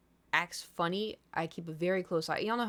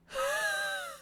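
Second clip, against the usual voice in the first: cat sigh. Retold or imitated. Cat noise after quiet.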